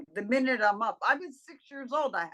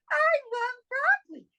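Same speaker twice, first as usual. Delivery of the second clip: much higher, louder delighted love for broccoli; happy and bright; 13 semitones higher, 5 dB louder than usual. Shown as a demonstration. Speaker contrasting a happy word with a heavy refusal. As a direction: burst with joy. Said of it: "Look at the difference".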